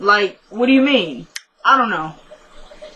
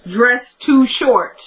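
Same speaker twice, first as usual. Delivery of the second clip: slow read-out; 2 semitones higher, much slower than usual. Shown as a demonstration. Reading her own written note.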